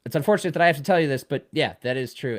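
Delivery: high, loud reassuring rush. Retold or imitated. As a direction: soothe an embarrassed stranger fast. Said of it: "I was like".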